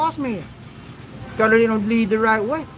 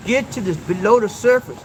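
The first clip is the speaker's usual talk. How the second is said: normal talking voice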